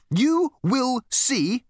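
very slow, high, emphatic firmness; firm. A narrator's reading. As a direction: spell it out word by word. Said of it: "continued the girl firmly".

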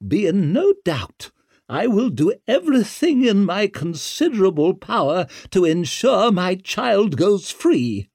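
high, loud, forceful vow; imperious noblewoman. A narrator's reading. Mother vowing to free her child, fiercely determined. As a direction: declare it as a warning to them all.

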